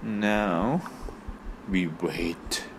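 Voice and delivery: low, hushed epic movie-trailer delivery; dramatic movie line. Acted. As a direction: say it like a tense film hero. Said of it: "So you watch too many movies".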